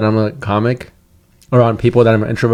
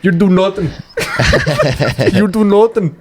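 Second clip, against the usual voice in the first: bad Irish accent; 5 semitones higher than usual. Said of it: "You're terrible at this accent".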